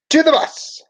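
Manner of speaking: high bright command to board; ordering. A narrator's reading. Teacher ordering her class onto the bus, high and commanding. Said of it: "she ordered".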